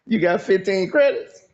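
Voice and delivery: high-pitched questioning woman; woman asking. Acted. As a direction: lift it high into a drawn-up question. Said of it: "she gonna be like".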